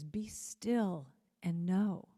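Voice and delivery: slow and spaced; slow, drawn-out quote. Shown as a demonstration. Speaker savoring a favorite saying, slow and spaced. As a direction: let each word settle, slowly. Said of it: "one of my favorite expressions".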